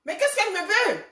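high, squeaky mock disbelief on encore; surprised retort. Retelling her reaction to the friend, high astonished outburst. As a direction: act out her startled, exasperated reply. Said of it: "J'ai dit".

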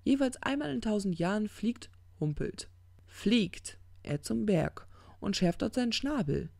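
dogged parable teller; impatient demon. Acted. Crowley resumes the parable.

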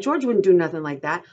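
loud, insistent protest; crying out. A narrator's reading. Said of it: "Lenny cried".

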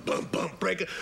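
usual speaking voice

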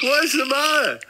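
high, loud-ish cry of protest; high-pitched protest. Acted. Tourist held in the kiosk protests, high-pitched. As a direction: plead in a high put-on voice.

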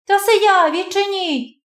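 put-on scared girl begging to come in; high, urgent, anxious girl's voice; frightened plea.

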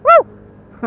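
high, loud whoop of joy. Real, not acted. Cheering on the bike.

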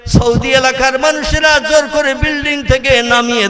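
high, ringing chanted plea; full-force melodic preaching. Acted. Pilgrim at the grave grille calling on Allah, full-force preaching. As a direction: cry out the prayer in sermon tune.

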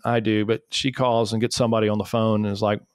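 normal talking voice

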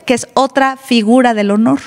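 normal talking voice

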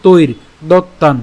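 তৈর with the reph as র, then a held দত্তান; pronunciation demo. Shown as a demonstration. Teacher demonstrating the reph in তৈর দত্তান. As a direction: say তৈর দত্তান slowly and firmly. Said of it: "তাহলে উচ্চারণটা কেমন হচ্ছে?".

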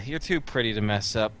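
low, unhurried threat, softening at the end; matter-of-fact. Acted. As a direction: state the threat as simple fact. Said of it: "he's gonna say matter-of-factly".

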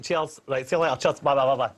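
normal talking voice

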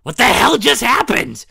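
loud, high, stunned outburst; shocked yell. Acted. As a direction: shout it in disbelief. Shocked after the poof.

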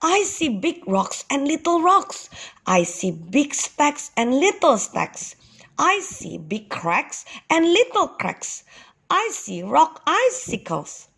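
high-pitched, excited listing. A narrator's reading. Young ox tells father what he sees, excited. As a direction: count off the wonders with lifted pitch. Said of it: "said Ex-Eddie Ox excitedly".

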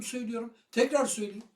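normal talking voice

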